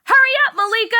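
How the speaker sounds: high, loud yell across the lunchroom; impatient yell. A narrator's reading. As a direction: shout at her from far back. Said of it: "Char's yelling".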